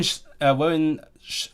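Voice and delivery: ordinary talk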